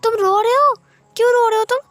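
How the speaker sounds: high, loud startled question; suddenly alarmed at seeing him cry; shocked. Acted. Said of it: "wing तो हैरान था".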